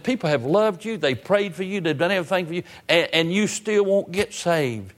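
retold exasperation, pitched high; half mad. Preacher retelling his rebuke of the young man, exasperated. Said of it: "I walked in about half mad".

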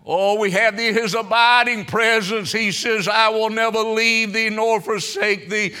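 high, loud sustained preaching; preaching at full force. Real, not acted. Preacher assuring believers the King visits, full-force preaching. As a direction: drive each phrase home to the congregation.

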